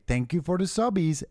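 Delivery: high sing-song group thank-you; high choir voice. Retold or imitated. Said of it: "everyone in a choir".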